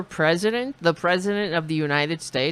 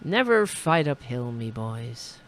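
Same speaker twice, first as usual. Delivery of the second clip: put-on Irish accent; 4 semitones lower than usual. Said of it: "I didn't know he was Irish".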